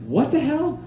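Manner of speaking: high incredulous what the hell; baffled. Retold or imitated. Comic reacting to the lyrics, high baffled squeak. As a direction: squeak it out in disbelief. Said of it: "once again like".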